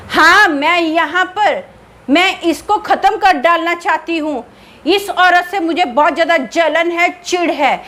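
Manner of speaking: jealous ghost. Acted. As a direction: hiss out her jealousy like a spirit. Jealous ghost daughter-in-law speaks. (ऊँची, धीमी, जलन भरी भूतनी की आवाज़)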